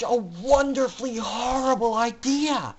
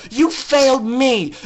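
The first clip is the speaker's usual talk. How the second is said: furious; 5 semitones higher, 6 dB louder than usual. Acted. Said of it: "remembering her anger".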